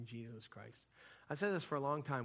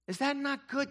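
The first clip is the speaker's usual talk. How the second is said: much higher, louder call to the congregation; preacher's call; 13 semitones higher, 8 dB louder than usual. Shown as a demonstration. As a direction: throw the question out to the room.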